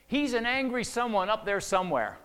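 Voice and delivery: imitated angry outburst, high and loud; angry blurted answer. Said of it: "immediately she went like this".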